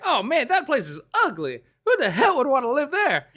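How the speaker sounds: high, loud, laughing Donkey imitation; high goofy Donkey sneering at the lair. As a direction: squeal it like Donkey, half laughing. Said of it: "And he's like".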